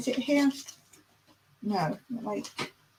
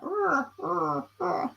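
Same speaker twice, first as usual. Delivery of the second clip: high, quiet repeated caws; crow caw attempt; 7 semitones higher, 6 dB quieter, slower than usual. Shown as a demonstration. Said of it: "what about a crow?".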